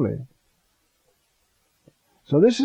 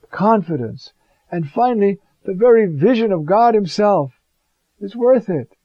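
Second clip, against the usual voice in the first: higher and louder, building with fervor; full-force preaching; 5 semitones higher, 4 dB louder than usual. Acted. Pastor exhorts his congregation, rising, fervent preaching. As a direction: lift the room on each word of promise.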